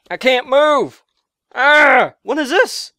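loud, high-pitched exasperation; frustrated outburst. Real, not acted. Gamer stuck and unable to move, exasperated yell. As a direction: cry out in frustrated alarm.

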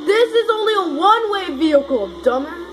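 high, whiny frustration; frustrated. Real, not acted. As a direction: complain to your teammate. Angry at teammate, crying heard.

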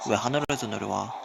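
ordinary delivery

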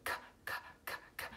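"k" as bare, unvoiced clicks; letter sound demo. Shown as a demonstration. Teacher counting four sounds for pupils, whispered "k" demo. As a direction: tap out "k" softly, sound by sound. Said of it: "So you're gonna hear".

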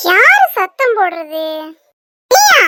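put-on mother snaps at the girl she blames; angry demand. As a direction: confront the intruder, voice rising. Angry mother confronts visitor.

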